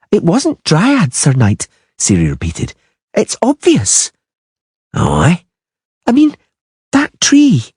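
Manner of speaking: put-on girl catching out the killers; clever little girl. A narrator's reading. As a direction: play the girl, pointed and eager. Ciri voiced by narrator.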